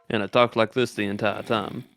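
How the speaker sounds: usual speaking voice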